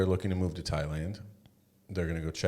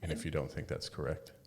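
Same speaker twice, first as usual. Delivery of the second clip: ordinary talk, nothing marked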